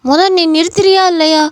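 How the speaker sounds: pleading shout to break up a fight; loud demand. Acted. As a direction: yell at them to stop it. Stopping a fight.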